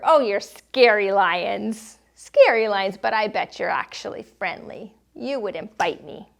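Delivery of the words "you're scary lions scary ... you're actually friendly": ordinary talk, nothing marked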